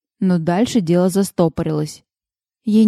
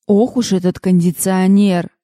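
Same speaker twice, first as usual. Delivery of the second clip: ordinary delivery, nothing marked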